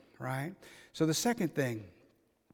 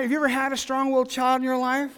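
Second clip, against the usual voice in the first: asked again much louder, higher and faster; loud, fast repeat; 9 semitones higher, 8 dB louder, faster than usual. Shown as a demonstration. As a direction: fire the question again at the room, fast and loud. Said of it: "same question asked again".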